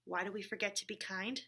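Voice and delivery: ordinary delivery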